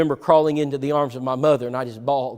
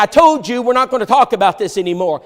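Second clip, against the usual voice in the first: louder and higher, hammering each word; retold angry shout; 6 semitones higher, 6 dB louder than usual. Furious father silencing his son, retold shout. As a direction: pound the words like a fist on the table.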